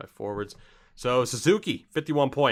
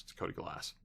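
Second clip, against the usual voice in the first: soft hushed answer; whisper; 13 dB quieter than usual. Real, not acted. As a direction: whisper the name to yourself. Quiet answer to own question.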